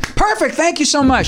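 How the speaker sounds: very high mock-diva thanks; high, intense diva impression. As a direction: imitate a star, pitched sky-high. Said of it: "And she went".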